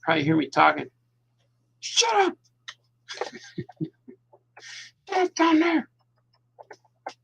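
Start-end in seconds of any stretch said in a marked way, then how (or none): 1.8-3.9 mock yell. Yells at imaginary critic. 5.1-5.9 mock yell. Yells at house downstairs.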